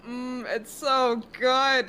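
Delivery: slow, drawn-out relish; slow, savoring "it's so good". Real, not acted. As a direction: stretch it out like tasting something good. Savoring the aesthetic.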